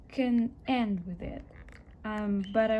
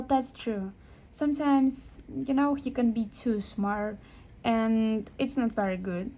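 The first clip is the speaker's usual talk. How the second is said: normal talking voice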